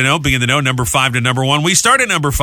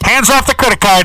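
mock stern order; 8 semitones higher, 5 dB louder than usual. Husband stopping wife's cookie order.

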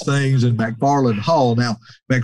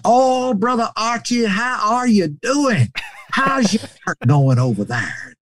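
high, drawn-out, exuberant greeting; high-pitched old preacher on the phone; Brother Cates; 10 semitones higher than usual. Retold or imitated. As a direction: greet a dear friend in a high, slow drawl. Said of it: "he got on the phone and".

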